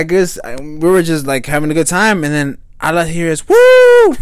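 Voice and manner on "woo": high loud party whoop. Retold or imitated. Friend whooping while dancing with a girl, loud whoop. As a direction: let out a wild woo.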